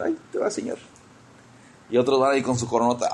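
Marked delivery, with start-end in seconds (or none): 0.0-0.8 much higher, softer put-on voice; crown-tosser's voice. Acting believers with tiny crowns tossing them to the Lord, high small voice. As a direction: lightly call out in a small, high voice. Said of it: "se la van a tirar así al Señor".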